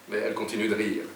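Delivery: usual speaking voice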